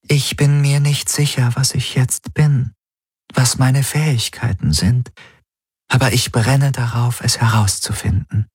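deutlich höher, gespannt und neugierig; eifrige KI-Stimme. Acted. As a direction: voller Vorfreude auf das Neue. Helix voiced by narrator. (hohe, eifrige Maschinenstimme)

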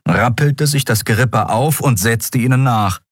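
plain reading voice

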